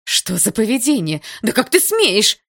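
high, loud scandalised scolding; indignant cry, climbing in pitch; shouting. A narrator's reading. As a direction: shout in outrage at the boy. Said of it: "закричала одновременно с ним Атом".

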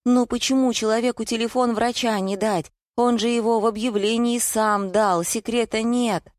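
high-pitched wheedling plea; fawning. A narrator's reading. Wife excusing herself to her husband, fawning. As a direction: justify yourself in a high, ingratiating voice. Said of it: "залебезила супруга".

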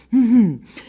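short, high refined chuckle; amused titter. A narrator's reading.

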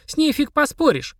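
put-on high, pompous girlish voice; little know-all clinching the argument; self-important child. A narrator's reading. Girl lecturing the demon, high self-important voice. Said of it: "важно подняла палец Полина".

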